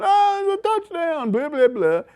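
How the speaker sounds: high, loud mock play-by-play call; a hammed-up announcer shouting the touchdown. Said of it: "you hear him going".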